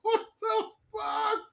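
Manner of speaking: high squeaky laugh-cry what the fuck; laughing disbelief. Real, not acted. Streamer losing it at Pelosi quote, laughing. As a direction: crack up mid-word.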